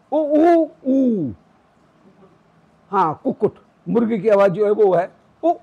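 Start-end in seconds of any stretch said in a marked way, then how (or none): 0.1-1.3 a rooster's call, stretched out on उ. Shown as a demonstration. Teacher imitating a rooster for students. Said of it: "वो मुर्गे की आवाज है".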